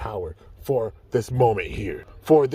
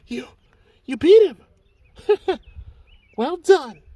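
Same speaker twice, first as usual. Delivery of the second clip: much higher and excited after the win; high joyful spirit voice; high-pitched ally voice; 11 semitones higher than usual. Acted. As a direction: cheer the victory, breathless and relieved.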